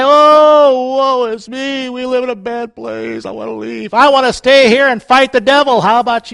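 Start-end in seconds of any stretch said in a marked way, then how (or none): 0.0-4.0 high, sagging self-pitying moan, fading off; mock whining. Preacher mocking quitters, whiny woe-is-me voice. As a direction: ham up a weepy complainer. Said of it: "I'm not gonna cry".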